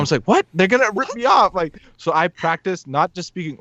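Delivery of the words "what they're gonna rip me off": retold disbelief. Acting out disbelief at uncle's advice.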